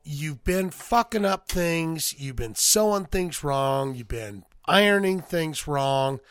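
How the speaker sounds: slow, deliberate mock scolding; measured boss lecture, lightly acted; slow reprimand. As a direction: spell out each failing slowly. Boss sitting worker down to scold.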